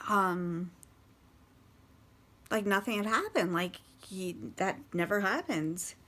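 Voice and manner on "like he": ordinary talk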